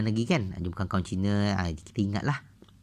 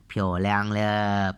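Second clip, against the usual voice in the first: slow model pronunciation; much slower than usual. Shown as a demonstration. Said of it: "Piaoliang ni cantik".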